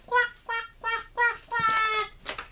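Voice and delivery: high, slow wailing quacks; crying duck quacks. Pretend. Mom voices crying toy duck.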